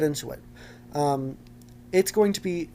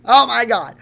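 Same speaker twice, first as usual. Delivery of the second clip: high, hammed-up scream; very loud outburst; 9 semitones higher, 15 dB louder than usual. Host reacting to TikTok ban, very loud hammed-up outburst. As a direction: belt it out in mock despair. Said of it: "I'm sorry if that was very loud".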